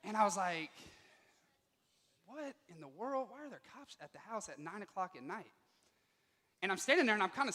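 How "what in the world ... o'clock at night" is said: stunned hushed disbelief. Retold or imitated. Teen facing cops at the door, quietly bewildered. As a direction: let the shock drain your voice.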